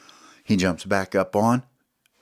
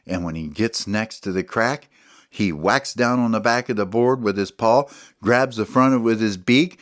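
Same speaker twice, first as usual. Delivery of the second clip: ordinary delivery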